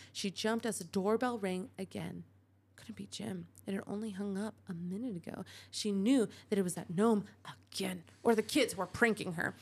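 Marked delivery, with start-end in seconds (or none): none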